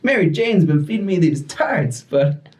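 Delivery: high, cheesy superhero voice; hammed-up Spider-Man. Spider-Man answers the kid who called him fat, high and hammy. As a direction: play the jolly hero brushing it off.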